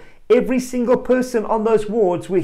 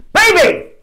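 put-on much higher, louder shout commanding the dead baby; high loud command, slowing on come back to life; 10 semitones higher, 7 dB louder than usual. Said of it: "he shouts".

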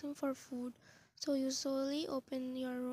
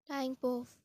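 ordinary delivery, nothing marked

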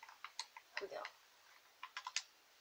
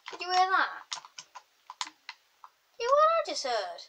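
raised, high startled voice; jumpy and higher-pitched; alarmed; 9 semitones higher, 6 dB louder than usual. Real, not acted. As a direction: react loudly to a creepy noise. Reacts to creepy game noise.

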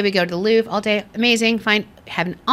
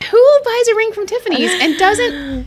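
pitched way up, disbelieving; high incredulous squeal; 9 semitones higher, 5 dB louder, slower than usual. Retold or imitated. Retelling the proposal, a high disbelieving squeal. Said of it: "I'm like".

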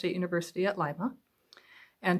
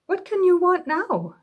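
much higher, louder, half-amused exasperation; mother wonders what more he wants; exasperated mother; 11 semitones higher, 4 dB louder than usual. Acted. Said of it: "said Mother Bear".

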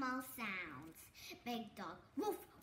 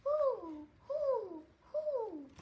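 higher, louder hooting; owl hoot; 6 semitones higher, 5 dB louder, much slower than usual. Shown as a demonstration.

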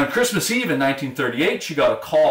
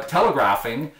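ordinary delivery

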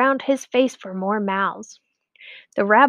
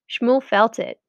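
ordinary delivery, nothing marked

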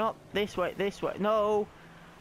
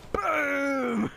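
a loud, high yell of triumph; triumphant boom; 9 semitones higher, 4 dB louder than usual. Real, not acted. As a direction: gamer yelling boom as he scores a goal. Scoring a goal, shouting.

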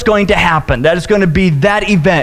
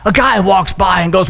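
normal talking voice

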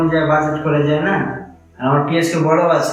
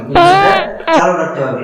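much higher, louder, slow and breaking with crying; tearful plea; 13 semitones higher, 5 dB louder, slower than usual. Acted. Preacher begging the organisers to keep the gathering going, tearful. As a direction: beg them with tears in your voice.

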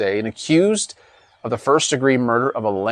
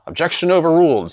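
judge voice; 6 semitones higher, 4 dB louder, faster than usual. A narrator's reading. Judge rules in story.

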